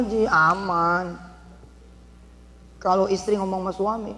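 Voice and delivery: ordinary talk, nothing marked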